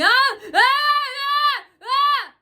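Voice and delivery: piercing high imitated screams; loud, high screams mimicking hecklers; mock scream. Vlogger shows how they screamed while the clerk was talking. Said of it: "they start screaming".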